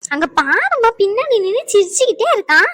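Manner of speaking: tattling whine. Acted. Tattling to mother about sibling.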